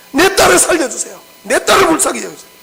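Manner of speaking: desperate plea. Retold or imitated. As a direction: plead for your daughter's life. Mother begging Jesus for daughter. (딸을 살려 달라고 애원하는 여인, 높고 큰 목소리로 간절하게)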